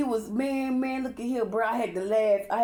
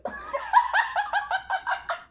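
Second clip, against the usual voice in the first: a sudden high, squealing laugh; high burst of laughter. Real, not acted. Laughing at her Matilda comparison.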